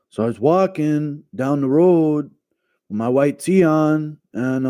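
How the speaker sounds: Brian Ortega. Acted. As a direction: stretch the words out with long pauses. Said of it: "Do your O G Ortega impression about an eagle".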